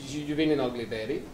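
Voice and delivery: ordinary talk, nothing marked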